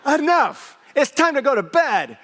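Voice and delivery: high, loud commanding shout down the stairs; yell. Retold or imitated. Pastor yelling at a fighting couple in the foyer, loud command. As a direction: shut them up and send them to bed. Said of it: "but I yell".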